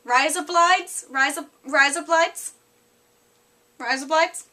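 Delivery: Australian accent with rising, questioning endings. Shown as a demonstration. Vlogger practises one of two phrases for viewers in Australian accent. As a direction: try out the Aussie phrase repeatedly. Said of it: "say with an Australian accent".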